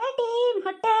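high, crying voice through tears; tearful greeting. Acted. Old woman greets her neighbour, crying high. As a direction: greet her while still sobbing. Mother-in-law weeping to neighbour.

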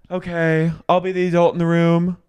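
high, loud lofty tone; lofty, self-important. Retold or imitated. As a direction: play the grown-up, grandly. Lisa's lofty peacemaking.

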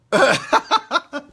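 loud, high laugh; real burst of laughter. Real, not acted.

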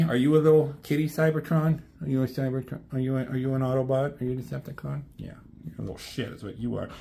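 soft, fast pet talk with a teasing edge; quiet teasing aside to a cat. Real, not acted. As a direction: coo at the cat, then mock it. Talking to the cat Rusty.

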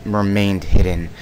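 ordinary talk, nothing marked

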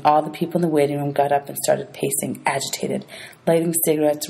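ordinary delivery, nothing marked

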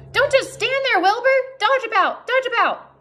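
very high-pitched goose voice; goose giving orders. A narrator's reading. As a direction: command them as a high, squawking goose. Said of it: "began to give orders".

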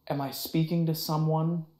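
ordinary talk

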